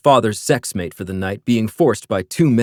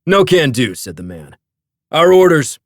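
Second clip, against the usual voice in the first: smug guard voice; 5 semitones higher, 5 dB louder than usual. A narrator's reading. Security man refusing.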